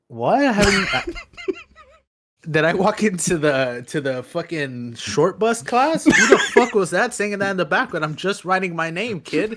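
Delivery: play-acted flustered teacher; mock indignant. Teacher confronting the heckler, mock indignant through laughter.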